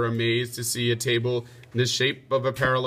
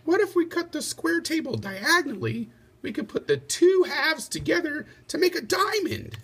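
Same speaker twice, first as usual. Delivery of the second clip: Lady Di's voice. Acted. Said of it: "Lady Di thought a moment and then said".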